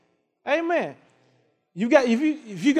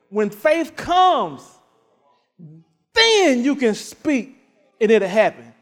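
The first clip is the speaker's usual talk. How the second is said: slow preaching; 4 dB louder, slower than usual. Acted. Preaching emphasis.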